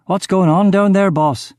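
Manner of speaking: alarmed henchman calling down, pitched well up; shout down the stairs. A narrator's reading. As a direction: shout it from the floor above. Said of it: "up the stairs to shout".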